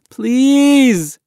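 long, high, loud cry; desperate begging. A narrator's reading. Boy being pried off the dinghy, desperate drawn-out begging. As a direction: draw the plea out into a cry. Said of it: "Mahmoud begged".

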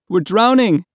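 high, loud cry for rescue; desperate begging. A narrator's reading. Drowning boy begs the people in the dinghy, loud desperate cry. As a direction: shout it as a desperate plea. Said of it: "Mahmoud begged".

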